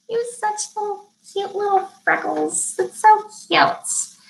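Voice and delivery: put-on high-pitched baby-talk fawning; cutesy gushing. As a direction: coo over something adorable, mock-sweetly. Mimics unprofessional gushing over freckles.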